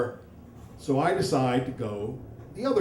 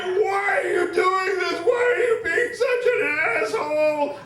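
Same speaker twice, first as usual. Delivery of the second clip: furious screaming; 6 dB louder than usual. Retold or imitated. Wife yelling at husband during delivery, furious. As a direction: scream it in a hammed-up fury. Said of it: "my wife is furious".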